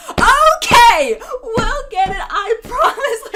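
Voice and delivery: high, very loud, pounded-out reply; mock shouting back. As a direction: imitate a coworker hammering words back at a customer. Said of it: "like, beating back at her".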